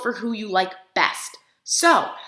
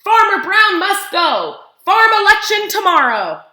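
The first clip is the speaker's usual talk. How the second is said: higher, louder rallying cry; sign read out loud; 9 semitones higher, 8 dB louder than usual. A narrator's reading. Reading the duck's protest sign to the kids as a loud rallying cry. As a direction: proclaim it like a protest banner.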